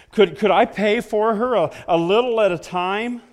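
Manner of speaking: put-on eager little boy. Little boy pleading to buy puppy.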